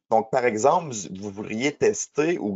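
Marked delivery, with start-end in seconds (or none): none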